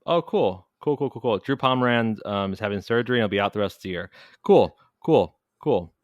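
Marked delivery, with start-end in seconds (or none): 0.0-1.4 loud, bitter sarcastic "cool". Real, not acted. Cohost reacting to injury news on Twitter, sarcastic. 4.4-5.9 much louder, bitter "cool, cool"; sarcastic repeated cool. Real, not acted. Cohost hearing Pomeranz is out for the year, sarcastic shout.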